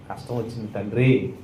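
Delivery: a prayer that swells from a murmur to a cry; pleading prayer. Shown as a demonstration. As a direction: plead with God, building up. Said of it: "ప్రార్థన చేయి".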